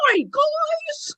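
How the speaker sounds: very high, strained ventriloquist voice; high-pitched puppet hello; Tommy. Acted. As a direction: squeal a greeting like a channelled childlike spirit. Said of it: "that's his go-to hello".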